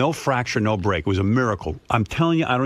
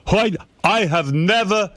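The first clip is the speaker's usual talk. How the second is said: slow, higher, outraged mock father; indignant father; 9 semitones higher, slower than usual. Father indignant at wife reading fortunes, slow and higher. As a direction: huff like an offended dad. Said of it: "He was like".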